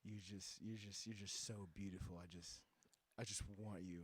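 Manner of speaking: lightly voiced creep pleading; a hint of a lovesick stranger; mock seductive man. Man hitting on Tangen, retold.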